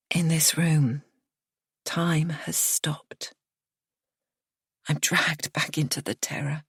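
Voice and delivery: slow hushed whisper; whispered, frozen terror. A narrator's reading. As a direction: let the dread hush you. Narrator whispers the dread.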